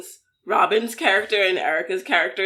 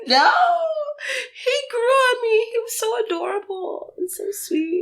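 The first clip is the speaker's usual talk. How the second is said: high, wailing mock grief; high, half-laughing lament for a lost character; mock heartbroken; 13 semitones higher than usual. Real, not acted. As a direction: grieve like a fan who lost a favourite. Mourning a character, voice breaking.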